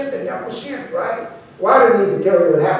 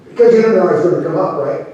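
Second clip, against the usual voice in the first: usual speaking voice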